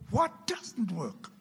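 a hint of a puzzled father; puzzled dad. Dad puzzled by his son's complaint, lightly voiced. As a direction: ask it like a baffled dad.

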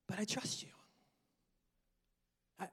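whispered prayer. Real, not acted.

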